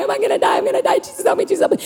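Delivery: frantic high panicked gabble; freaking out. Acted. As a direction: gasp out a mock-desperate plea. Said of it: "I start freaking out".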